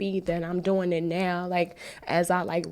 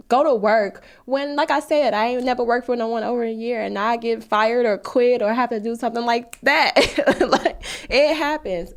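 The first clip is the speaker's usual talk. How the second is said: worked up; 5 semitones higher, 6 dB louder than usual. Real, not acted. Venting about jobs, laughing.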